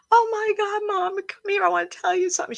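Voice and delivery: put-on a teenage girl screaming for her mom in excitement; excited yelling. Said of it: "She was yelling".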